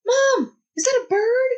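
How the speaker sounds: put-on high excited child voice; little kid. Mom imitating kid asking about night sounds, high childlike. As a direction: ask like an eager small child. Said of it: "one of the little kids in the tents would be like".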